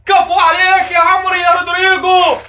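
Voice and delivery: high, loud elated cheering; excited praise. Real, not acted. Fan praising Rodrygo after the goal, elated high cheering. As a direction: praise the scorer with joy.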